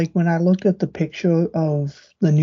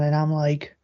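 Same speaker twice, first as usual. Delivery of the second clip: normal talking voice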